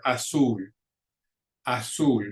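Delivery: azul with the stress on -ZUL; stress on last syllable. Shown as a demonstration. Teacher giving an example word, azul stressed on the last syllable. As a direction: model azul, leaning on the final syllable. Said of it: "stress goes on that last syllable".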